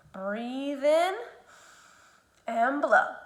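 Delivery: drawn out breathing cue; slow exaggerated speech modeling. Shown as a demonstration. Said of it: "teacher models breathing".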